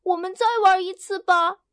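high, loud cheerful call; bubbling over with joy; happy. Acted. Hen Hena on the treetop, happy and high. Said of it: "他高兴地说".

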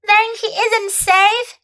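put-on high young girl, anxious; a child realizing danger; worried little girl.

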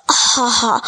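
spoken ho-ho chuckle; mild, put-on chortle; storybook laugh. A narrator's reading. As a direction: act out the goose's laugh lightly. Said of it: "大白鹅笑着说".

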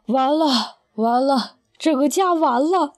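slow, despairing father's lament; long sigh of despair. A narrator's reading. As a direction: sigh it out slowly as a defeated father. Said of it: "爸仰天长叹".

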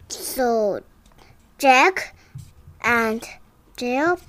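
ordinary delivery, nothing marked

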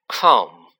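low, loud one-word order; sharp command. A narrator's reading. Malfoy ordering Dobby to follow, loud and commanding.

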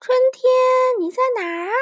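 high, loud call. Acted. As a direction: shout across the forest. Kids shouting for spring.

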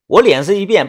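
usual speaking voice

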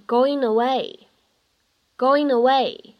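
"going away" slowly linked into one flow; linked reading. Shown as a demonstration. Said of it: "会变成going away". (慢速连读 going away)

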